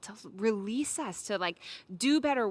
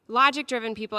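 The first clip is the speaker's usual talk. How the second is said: usual speaking voice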